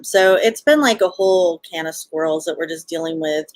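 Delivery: normal talking voice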